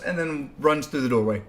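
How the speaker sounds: ordinary talk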